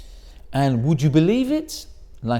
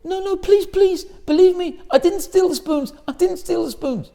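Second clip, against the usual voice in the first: much higher, louder desperate plea; pleading innocence; 14 semitones higher, 5 dB louder than usual. Retold or imitated. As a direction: beg him to believe you. Rabbi protesting innocence.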